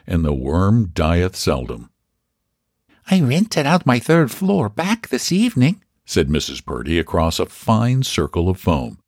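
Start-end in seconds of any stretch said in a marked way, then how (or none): 3.1-5.8 put-on high Irish-accented landlady; a proud Irish housekeeper boasting. Mrs Purdy speaks.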